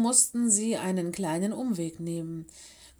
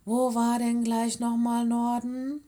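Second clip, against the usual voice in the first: puzzled musing; 4 semitones higher, 3 dB louder than usual. A narrator's reading. Lenny unsure where north is, puzzled and musing aloud. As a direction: wonder aloud, stretching the end. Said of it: "murmelte er".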